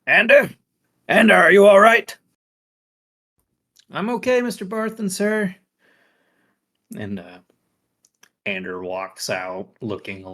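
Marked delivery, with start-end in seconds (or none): 0.1-2.2 put-on high, loud old man calling out; elderly man calling. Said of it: "an elderly voice go". 3.9-5.5 put-on high, nervous young man voice; flustered young assistant. Ander answers his elder boss.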